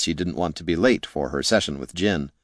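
usual speaking voice

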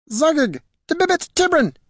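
very high-pitched creature chatter; squeaky creature squire. Acted. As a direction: squeal excitedly in a creature tongue. Creature squire speaks its own tongue.

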